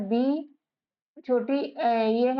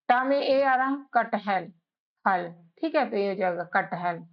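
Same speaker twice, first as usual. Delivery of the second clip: ordinary talk, nothing marked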